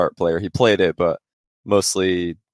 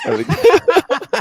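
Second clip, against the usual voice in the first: much higher, laughing through the words; can't hold the laugh in; giggling mid-story; breaking into laughter; 6 dB louder than usual. Real, not acted.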